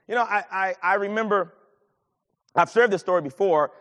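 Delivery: ordinary talk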